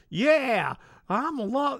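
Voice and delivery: high, drawn-out country drawl, imitated; country caller's accent. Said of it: "he started talking like that".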